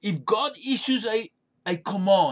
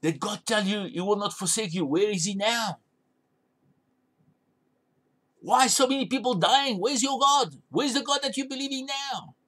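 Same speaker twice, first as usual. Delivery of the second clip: taunting devil; 3 semitones higher than usual. Retold or imitated. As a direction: needle them like a sly tempter. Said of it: "the devil whispering in your ear".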